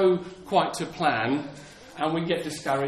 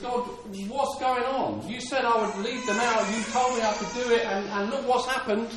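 higher-pitched pleading protest; acted exasperated complaint; 5 semitones higher than usual. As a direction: play a let-down servant protesting upward. Moses acted complaining to God.

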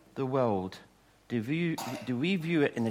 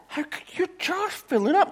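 much higher, a lightly voiced little boy; envious little boy. Boy asking the girl about her jar, high puzzled voice. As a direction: squeak it out like a puzzled small kid. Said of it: "the boy's looking, going".

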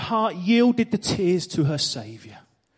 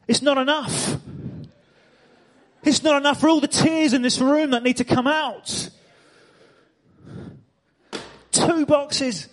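full-force preaching; 6 semitones higher than usual. Acted. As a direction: preach it out to the whole room.

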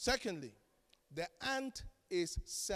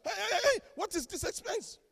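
high, loud mock outrage; mock alarmed spouse; 12 semitones higher, 5 dB louder than usual. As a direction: play the spouse demanding answers about the bill. Spouse confronting over expense.

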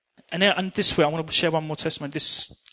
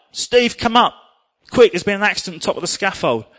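urgent call; 5 semitones higher, 7 dB louder than usual. Retold or imitated.

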